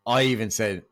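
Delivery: ordinary talk, nothing marked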